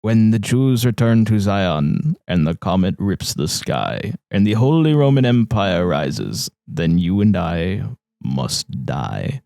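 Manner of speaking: slow, solemn reading of a prophecy poem; measured, grave recital. Acted. Friend reads the ominous prophecy poem aloud, slow and solemn.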